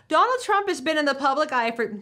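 first stab at an impression, not fully in voice; Sarah Palin. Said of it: "had to get my Sarah voice on".

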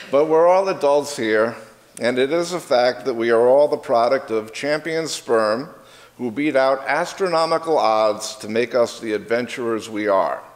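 ordinary delivery

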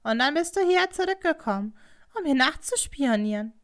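loud indignant question; loud accusation. A narrator's reading. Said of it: "Das sagte Hatsumomo so laut".

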